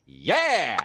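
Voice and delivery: loud, high shout of triumph; battle cry. Acted. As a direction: yell it as she fires. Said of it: "all you hear her do is go".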